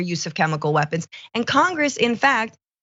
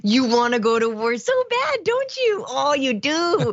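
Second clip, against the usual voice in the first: mock baby talk; 5 semitones higher than usual. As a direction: coo it like teasing a toddler. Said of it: "it's just kind of adorable".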